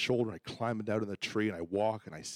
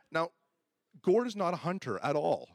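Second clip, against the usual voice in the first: ordinary talk, nothing marked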